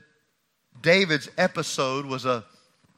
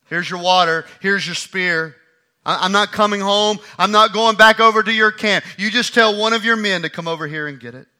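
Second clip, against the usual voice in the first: loud, firm calling back; firm loud refusal; 5 semitones higher, 9 dB louder than usual. Acted. David refusing Saul's offer, firm and loud. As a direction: call it out, not backing down.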